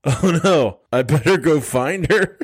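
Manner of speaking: chirpy, careless mock worry; mock husband. Mocking the husband in the flood, chirpy and careless.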